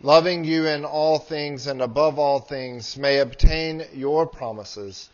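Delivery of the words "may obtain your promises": ordinary delivery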